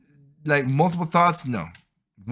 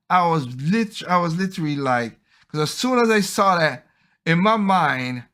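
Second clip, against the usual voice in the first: ordinary talk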